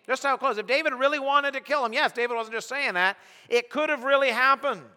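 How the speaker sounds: high, loud, driving sermon voice; full-force preaching. Acted. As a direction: preach it out to the whole hall. Preacher imagining Saul's realisation.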